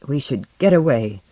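ordinary delivery

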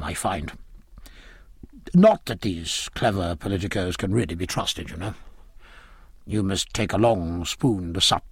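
confiding low, then heartily hospitable; jovial, knowing admiral. Acted. Admiral advises Aubrey and turns to his lobsters, jovial. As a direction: drop low to advise, then brighten with a chuckle.

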